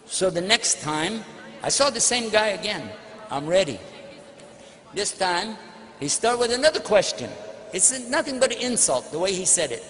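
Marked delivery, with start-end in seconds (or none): none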